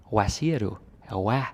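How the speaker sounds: Hvad with a W, "wæ", mock Jutlandic; mild Jutlandic W. Teacher contrasts Jutland Hvad, mild mock accent. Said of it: "that's like a W sound".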